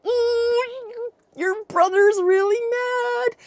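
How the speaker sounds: high, drawn-out "ooh, you're in trouble" tease; mock-ominous high sing-song; sing-song warning. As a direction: imitate a mother's slow, high warning. Mother warns son of brother's anger.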